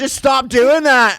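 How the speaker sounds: slow, loud, high Scottish brogue. Retold or imitated. Mimicking Gary John Bishop telling her to stop, in a slow loud Scottish brogue. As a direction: drag it out in a hammed-up Scottish brogue. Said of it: "that Scottish brogue".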